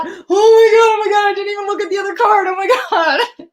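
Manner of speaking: squealing high and loud, words tumbling out fast; overjoyed, laughing through it; real excitement. Real, not acted. Reader sees the other card matches, squealing excitedly. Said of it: "Sorry, I get so excited when I read".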